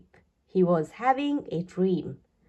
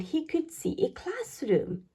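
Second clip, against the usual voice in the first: hushed whisper; 6 semitones higher, 7 dB quieter than usual. A narrator's reading. Peeping in secret at classroom.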